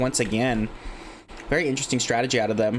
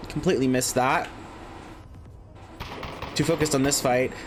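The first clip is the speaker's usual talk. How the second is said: higher, quicker caster calling the fight; live play-by-play; 4 semitones higher than usual. Acted. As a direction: call the action as it unfolds.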